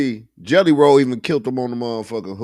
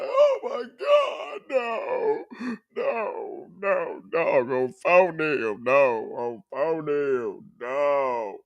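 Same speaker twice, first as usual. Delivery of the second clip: fake-cry like a crushed fan; mock crying; 3 semitones higher, 6 dB quieter, slower than usual. Friend imitating No Life Shaq crying over the album. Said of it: "He's like".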